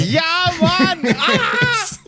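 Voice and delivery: high laughing shout; laughing at the Justus the Third joke. Real, not acted. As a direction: burst out laughing.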